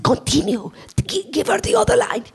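urgent high stage whisper; breathy, hushed cueing; director's whisper. Retold or imitated. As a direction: imitate a director hissing prompts off-mic. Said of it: "and the director goes".

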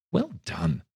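high, bright cheerful shout; Fezziwig's hearty cry. A narrator's reading. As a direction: cry it out like jolly old Fezziwig. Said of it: "cried out".

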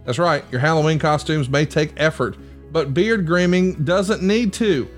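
ad read. Acted. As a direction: pitch the product like a host ad. Host reads sponsor ad.

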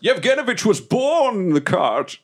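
high-pitched theatrical proclamation; mock-epic shout. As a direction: proclaim it like an over-the-top legend. Player chants character's legend loudly.

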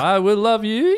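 high, far whale cry; whale call. Retold or imitated. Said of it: "started doing a whale call".

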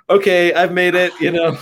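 half-joking triumph at the big order; thrilled. Retold or imitated. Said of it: "I'm like".

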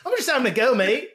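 high, laughing put-on British voice; mock British banter. Playing Ricky Gervais while laughing, in a high mock British voice. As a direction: tease like a cheeky Brit.

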